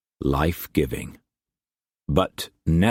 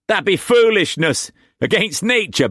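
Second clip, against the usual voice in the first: put-on much higher, hammy old sailor voice; old sea-dog Brock; 14 semitones higher, 4 dB louder than usual. Brock welcoming Struan to his table, hammed-up old sailor. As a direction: boom it out like a rough old trader.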